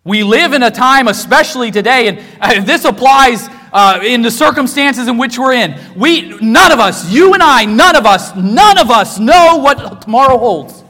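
loud, high pulpit delivery; impassioned preaching. Acted. Preacher tells congregation none of us knows tomorrow, impassioned preaching. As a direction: preach it hard, pounding the repeated phrases.

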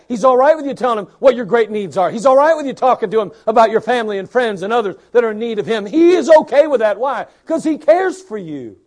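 rising, loud preacher build; preaching at full force. Acted. Preacher building to a shout for the congregation.

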